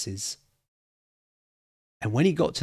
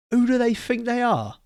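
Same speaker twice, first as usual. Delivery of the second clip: rhetorical outburst; 13 semitones higher, 4 dB louder than usual. Acted.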